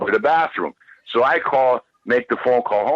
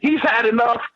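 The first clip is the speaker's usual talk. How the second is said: high pleading plea, much higher; high imitated pleading colonel; 10 semitones higher than usual. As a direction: beg him to stop in a high pitched voice. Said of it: "Colonel Park said".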